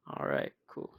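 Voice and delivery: breathy, barely voiced aside; deflated whisper. Real, not acted. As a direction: mutter it out resigned under your breath. Narrator moves on, deflated.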